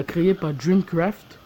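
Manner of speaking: ordinary talk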